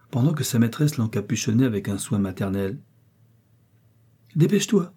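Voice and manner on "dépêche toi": high-pitched hurry-up; brisk urging. A narrator's reading. Mistress sending the maid off without listening, brisk urging. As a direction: brush the objection aside and urge. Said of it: "dit la jeune femme sans l'écouter".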